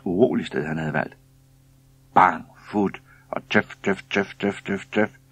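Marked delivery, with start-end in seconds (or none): 2.2-5.2 chugging, evenly spaced puffs after a bang; imitated engine noise. A narrator's reading. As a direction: mimic an old boat motor starting up. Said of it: "sagde det lige ved siden af ham".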